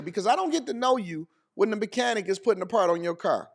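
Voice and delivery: ordinary talk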